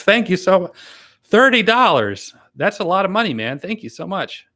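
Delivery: overwhelmed gratitude, barely holding it together; genuinely moved, thanking a donor through tears; choked up. Real, not acted. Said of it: "I try not to get too emotional about that".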